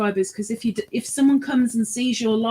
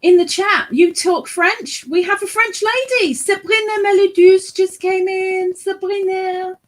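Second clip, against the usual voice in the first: high, loud, excited announcement; real excitement; 9 semitones higher, 6 dB louder than usual. Real, not acted. Co-host spots a French lady in the chat, excited. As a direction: call out the newcomer with excitement, voice pitched way up.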